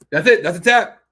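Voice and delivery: excited shout. Real, not acted. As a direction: shout the finish. Submission called live.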